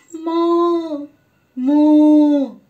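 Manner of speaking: long loud moo like a cow. Shown as a demonstration. Teacher imitating a cow for children to guess. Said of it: "close your eyes. Just listen".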